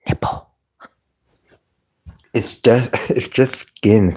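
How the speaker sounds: ordinary talk, nothing marked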